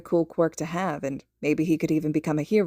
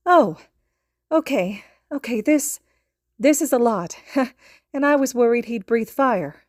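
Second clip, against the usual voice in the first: put-on higher, louder flustered young voice; a quick laughing aside in a raised voice; character voice; 6 semitones higher, 5 dB louder than usual. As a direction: play the worried character, a bit flustered. Summary quote, character voice.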